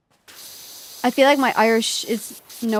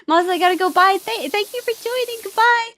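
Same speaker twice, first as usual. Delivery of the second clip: mock hasty farewell; 9 semitones higher than usual. Pretend hurried exit from embarrassment.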